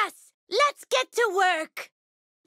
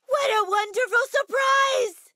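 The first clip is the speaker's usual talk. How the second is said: gasp, then high-pitched delight; delighted surprise; 5 semitones higher than usual. Acted. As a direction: exclaim it high after a gasp.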